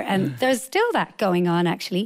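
ordinary talk, nothing marked

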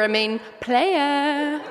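slow, drawn-out flirty tease, laughing; drawn-out cheeky playa. Pretend. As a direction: play it like a cheesy seducer. Flirty joke.

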